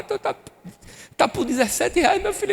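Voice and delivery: high, choked voice near tears; crying down the phone to his wife; tearful phone call. Retold or imitated. Husband calling home, voice breaking with tears. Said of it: "eu liguei chorando".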